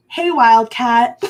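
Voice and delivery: slow drawn-out greeting; Gabriella voice. Retold or imitated. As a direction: stretch it out, sing-song. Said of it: "Gabriella's like".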